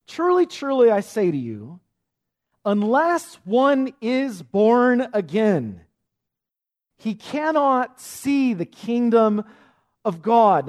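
slow, loud, high-pitched solemn declaration; most emphatic. Acted. Jesus speaks to confused Nicodemus, emphatic and weighty. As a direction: stretch "born again" and "cannot see" with great weight. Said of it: "saying this in the most emphatic, important way possible".

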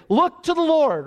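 high, loud pulpit call; mock preacher crying out to the crowd; preacher's cry. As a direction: call it out like an old street preacher. Preacher mimics old preacher.

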